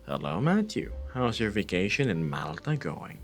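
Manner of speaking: ordinary delivery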